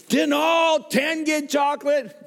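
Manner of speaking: high, slow, loud complaint; hammed-up chocolate demand; mock indignant shout.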